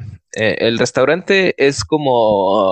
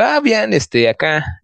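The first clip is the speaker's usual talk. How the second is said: high casual reply; 9 semitones higher, slower than usual. Retold or imitated. He answers the bar regulars in a high, breezy voice. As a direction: voice jumps way up, breezy.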